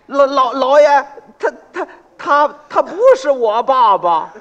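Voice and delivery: high, loud, slowed stammering denial; panicked stammer. Acted. Pot seller denies the old man is his father, stammering in panic. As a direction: stumble over the words in panic.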